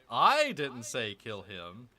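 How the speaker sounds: "I" loud and hit in "I didn't say kill him", slow; stress on I. Shown as a demonstration. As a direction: lean on "I" again, slow. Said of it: "wherever you're emphasizing".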